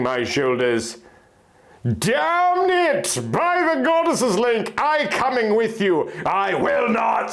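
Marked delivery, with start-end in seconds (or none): 2.0-7.3 stubborn outburst. Acted. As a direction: burst out in defiance at her husband. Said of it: "I retorted stubbornly".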